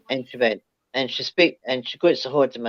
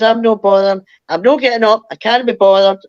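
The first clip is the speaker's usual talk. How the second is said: louder, higher, dug-in refusal; stubborn refusal; 7 semitones higher, 6 dB louder than usual. Retold or imitated. Refusing to get out of bed for the knocks, loud and stubborn. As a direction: plant your feet: not getting up.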